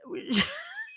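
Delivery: sudden high squealing laugh. Real, not acted. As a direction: burst into a squeaky giggle. Laughing about the poor woman.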